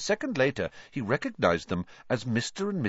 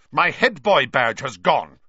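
angry; 6 semitones higher, 10 dB louder than usual. A narrator's reading. Percy furious over badge.

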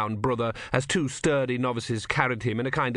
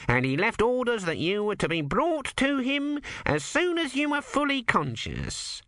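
lightly voiced nervous cleric, raised pitch; a fussy young monk, pitched up; high fussy monk; 12 semitones higher than usual. A narrator's reading. Numrod voiced by narrator.

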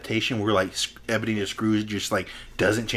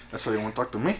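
softer gangster menace; Scarface; 8 dB quieter, much faster than usual. Acted. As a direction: challenge them like a movie drug lord. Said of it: "he's just sitting there like Scarface".

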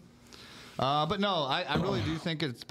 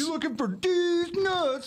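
mock high voice; 3 dB louder, slower than usual.

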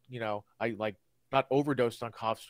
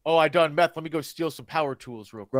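mock junkie voice; 3 semitones higher, 6 dB louder than usual. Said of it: "I'm thinking like".

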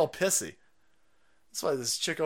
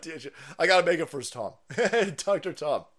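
usual speaking voice

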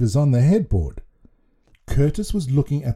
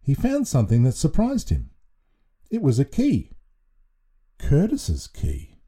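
ordinary delivery

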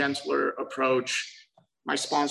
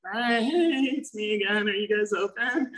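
eager high voice; 8 semitones higher than usual. Retold or imitated. Calling the club at 5 AM.

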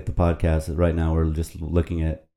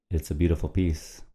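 ordinary talk, nothing marked

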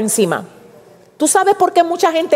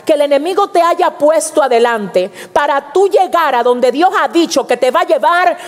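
normal talking voice

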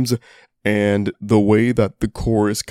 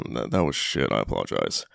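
muttered, embarrassed apology; sheepish mutter; 12 semitones lower, 7 dB quieter than usual. Real, not acted. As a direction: drop the voice, a little ashamed. Embarrassed after a bad joke.

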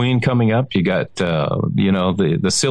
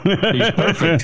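laughing; 6 semitones higher, slower than usual. Real, not acted. Joke about unplugging Alexa.

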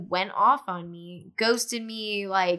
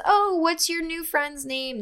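higher, drawn-out mock-sweet question; mimicked ex-friend; 7 semitones higher, slower than usual. Ex-friend asking past the girlfriend, imitated high sweet tone. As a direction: lightly imitate a girl fishing for names.

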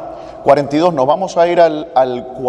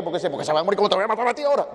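fast, hammed-up threat, rattled off; mock exasperated threat; 6 semitones higher, 5 dB quieter, much faster than usual. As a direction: pretend to lose patience with the child. Exasperated parent jokingly threatens child. (padre harto amenazando en broma al niño, muy rápido)

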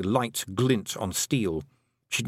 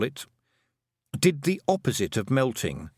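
plain reading voice

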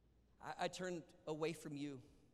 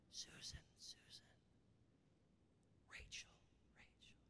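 God whispering the listeners' names, softly; 16 dB quieter than usual. Shown as a demonstration. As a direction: call each name as close as a breath. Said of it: "He whispers because he's close".

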